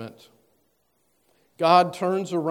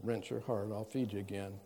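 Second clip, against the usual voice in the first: mild soft reassurance; 5 semitones lower, 9 dB quieter than usual. Retold or imitated. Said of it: "He doesn't just say".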